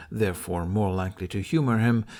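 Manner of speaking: plain reading voice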